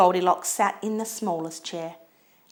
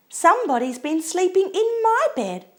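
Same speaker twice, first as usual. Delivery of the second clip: high, louder, sweetly exclaimed mother bear voice; sweet Mama Bear; 11 semitones higher, 5 dB louder than usual. A narrator's reading. Mama Bear finds messed covers, high sweet exclaiming. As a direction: exclaim it sweetly, pitched right up. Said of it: "in her sweet low voice".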